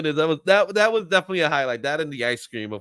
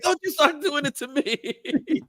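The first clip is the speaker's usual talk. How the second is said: laughing mock warning; 8 semitones higher, 4 dB quieter, slower than usual. Friend mimics vendor, laughing.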